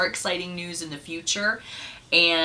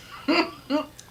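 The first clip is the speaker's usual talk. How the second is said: laughing at own joke; 11 semitones higher than usual. Real, not acted.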